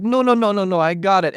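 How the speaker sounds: high-pitched imitation of a struggling lifter; mock panicked insistence. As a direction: wave off worried onlookers in a high voice. Acting the struggling lifter's protest.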